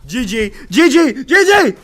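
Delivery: escalating high screamed "G G"; purposeful scream. Pretend. Streamer screams G G to annoy people, purposeful scream. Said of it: "I purposely scream just to piss some people off".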